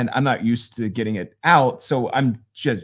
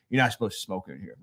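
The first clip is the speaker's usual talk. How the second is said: low, quick, quieter mock growl; lightly voiced old man, words tossed out fast and low; old smoker; 4 semitones lower, 6 dB quieter, faster than usual. Old man lighting another cigarette, muttered low and fast.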